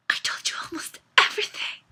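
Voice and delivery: fast, breath-caught whisper; horrified whisper. A narrator's reading. Mariana realising she told Caleb too much, whispering. Frightened realisation after gasp.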